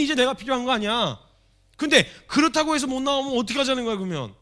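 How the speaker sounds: high, loud impassioned preaching; preaching at full force. Acted. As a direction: drive the point home to the congregation.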